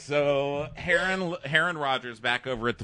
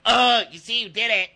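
high, loud whiny accusation; imitating a squealing little snitch; mock tattletale; 10 semitones higher, 7 dB louder than usual. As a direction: cry foul like a hammed-up victim. Acting tattling Alex.

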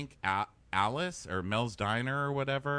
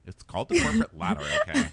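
mock dad; 14 semitones higher, 7 dB louder, faster than usual. Mocking the dad.

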